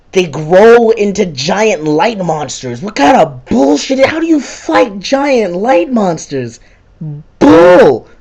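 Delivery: high, slow, drawn-out outburst; outraged disbelief. Real, not acted. Gamer protesting the giant light monsters, outraged. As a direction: stretch the words in squeaky outrage, ending loud.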